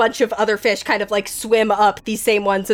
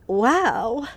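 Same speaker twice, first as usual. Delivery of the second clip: small awed fish; tiny fish voice. Acted. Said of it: "one little fish voice go".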